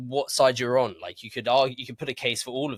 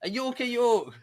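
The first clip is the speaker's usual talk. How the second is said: much higher mock Yorkshire battle cry; Yorkshire accent; 13 semitones higher than usual. Trying the Yorkist battle cry in a mock Yorkshire accent. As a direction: shout "A Yorker York" in a hammed-up Yorkshire accent. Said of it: "Why are you doing that accent?".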